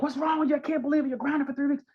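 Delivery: high, sharp mock scolding; scolding parent. Parent scolding a kid over an F, mock angry and high. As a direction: act out an exasperated parent.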